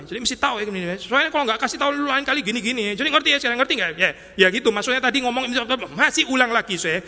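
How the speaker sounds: high-pitched mock scolding; hammed-up, pestering lecture; nagging reprimand. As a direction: act out someone who keeps repeating a telling-off. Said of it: "kayak kita negur orang".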